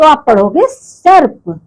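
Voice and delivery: सर्प drawn out slowly, the र clearly sounded; slow teaching demo. Shown as a demonstration. Teacher slowly demonstrating सर्प for the children. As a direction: spell सर्प out slowly for the class. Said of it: "आपने ऐसे रेव की मात्रा लगाई तो".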